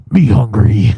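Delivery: low mock caveman voice; hungry grunting impression. Co-host mocks Jonathan getting upset and hungry, low caveman impression. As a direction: imitate a hungry friend as a caveman. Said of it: "when Jonathan starts getting upset and hungry".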